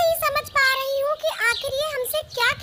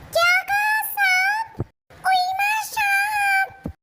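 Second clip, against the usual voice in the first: scared shriek; 5 semitones higher, much slower than usual. Acted. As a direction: panic at the sight of a snake. Child panics seeing snake. (साँप देखकर डर से चीखना)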